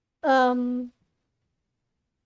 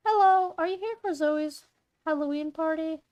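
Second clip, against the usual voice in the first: higher-pitched mom character voice; Zoe's mother; 3 semitones higher than usual. Acted. Mother greets Claire at the door, high voice. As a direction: lift the pitch to greet a guest. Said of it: "how I did Zoe's mother voice".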